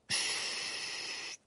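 sudden whispered shush; hushing. A narrator's reading. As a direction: breathe it out to silence them. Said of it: "murmura soudain Hermione".

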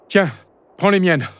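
put-on high young voice, urging; high-pitched, insistent youngster; son offering help. A narrator's reading. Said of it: "lui dit-il".

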